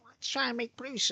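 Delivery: high-pitched mock women's voice; mock female voice. Imagined women plotting against Bruce, mock female voice. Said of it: "if it's female people, like, go".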